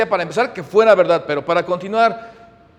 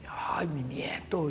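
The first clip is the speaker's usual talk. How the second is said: soft murmur; 3 semitones lower, 10 dB quieter, much slower than usual. Retold or imitated. Grandfather proudly murmuring.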